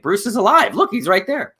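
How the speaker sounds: high, excited mock shout of discovery; excited bystander. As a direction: play a fan pointing out a celebrity in the street. Imagined onlookers spotting Bruce Wayne.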